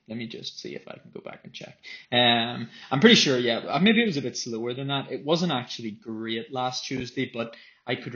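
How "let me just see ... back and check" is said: quick, under-the-breath mutter; muttering to self. Real, not acted. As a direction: mumble it to yourself while checking. Checking watch data under breath.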